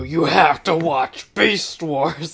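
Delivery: slow, dramatic cartoon hero voice; Beast Wars voice. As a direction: announce it like a heroic robot. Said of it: "you gotta use that voice".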